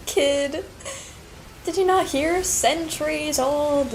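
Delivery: high, loud mock retort; hammed-up retort to a youngster; acting out character. Acts back at the game character.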